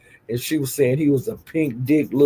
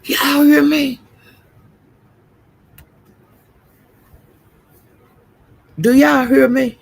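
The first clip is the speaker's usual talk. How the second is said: very high, incredulous squeal; scandalized disbelief; 11 semitones higher, 3 dB louder than usual. Real, not acted. Gossiper to viewers after shocking news, incredulous. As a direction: pitch way up, demanding they take it in.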